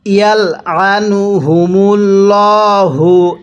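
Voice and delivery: slow, drawn-out tajweed chanting; slow Quran recitation. Shown as a demonstration. Teacher demonstrating recitation to students, slow chant. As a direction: recite each syllable long and measured. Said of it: "কিভাবে শিখব?".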